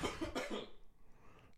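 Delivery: cracking voice mid-sentence; voice cracking up an octave. Real, not acted. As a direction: let the voice crack. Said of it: "And my voice cracked".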